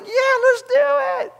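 high, loud, slow eager yes. Retold or imitated. Pastor retelling his eager yes to the motorcycles, high and loud. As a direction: pitch it way up like a giddy yes. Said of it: "I was like".

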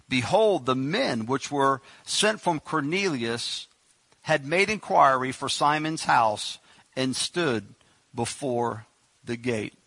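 slow, solemn reading of the verse; scripture reading. Acted. Preacher reading Acts to congregation, slow and solemn. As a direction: read the Bible verse slowly and gravely.

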